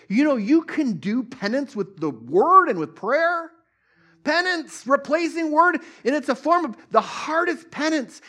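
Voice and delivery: high, loud, fervent sermon climax; full-force preaching. Acted. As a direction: preach it out with rising fire.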